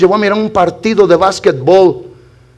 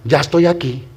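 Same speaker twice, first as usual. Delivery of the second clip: inner thought; 9 semitones lower, 7 dB quieter than usual. Retold or imitated. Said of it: "yo dije dentro de mí".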